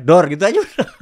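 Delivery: laughs through the words; chuckling as he wraps up the story; laughing. Real, not acted. Telling a friend the story, laughing.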